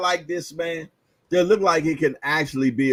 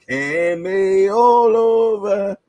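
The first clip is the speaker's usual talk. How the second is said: slow teasing punchline; 6 semitones higher, 6 dB louder, much slower than usual. Pretend. Punchline repeated drawn out.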